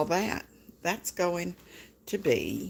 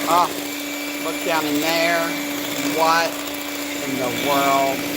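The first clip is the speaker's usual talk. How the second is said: loud, thrilled exclamations over the cake; excited gushing; 3 semitones higher, 7 dB louder than usual. Real, not acted. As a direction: gasp it out like you can't believe how good it looks.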